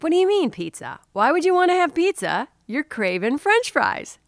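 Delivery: high, disbelieving protest; incredulous objection. Retold or imitated. Wife objecting to partner's pizza suggestion, incredulous. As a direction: challenge the suggestion, pitch jumping up then easing.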